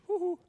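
high whoop; a sudden delighted whoop; whooping exclamation. Real, not acted. Preacher's exclamation. (glatt utrop)

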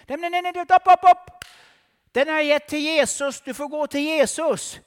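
high, loud shouting; loud refusal. Acted. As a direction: drive him off with your voice. Preacher rebukes the devil.

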